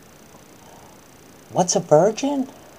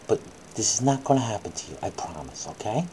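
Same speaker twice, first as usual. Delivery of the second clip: reassuring; 7 semitones lower, 8 dB quieter, faster than usual. Acted. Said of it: "comforting pretend crying child".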